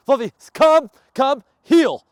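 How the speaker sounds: very high, loud recall command; recall shout. Real, not acted. Trainer shouting recall at distant dog, loud. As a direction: shout him all the way back.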